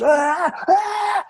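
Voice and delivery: very high-pitched recorded-scream imitation; two long, piercingly high wails; imitated scream. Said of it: "a scream".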